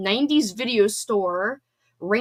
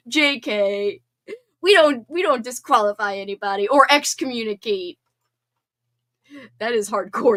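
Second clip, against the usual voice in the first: ordinary talk, nothing marked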